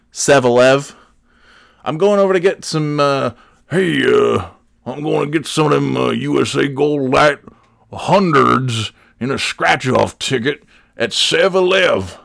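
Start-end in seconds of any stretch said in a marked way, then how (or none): 1.8-12.2 store customer voice. Acted. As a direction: play a customer ordering at the counter. Plays scratch-off ticket customer.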